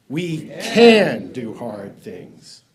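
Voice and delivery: CAN stretched out and punched loud; stress on CAN. Shown as a demonstration. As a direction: hit "can" hard and hold it, slowly. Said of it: "put the emphasis on the can".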